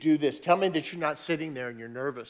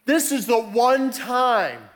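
much higher and louder, building to a fervent pitch; preaching at full force; 7 semitones higher, 5 dB louder than usual. Acted. Pastor driving home baptism to the congregation, full preaching force. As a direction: preach it hard, climbing with every line.

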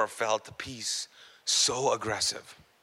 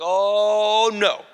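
long, loud, raised "oh no"; mock dismay; 5 semitones higher, 7 dB louder than usual. Woman retelling being shaken at night, mock dismay. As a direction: draw it out in comic dread.

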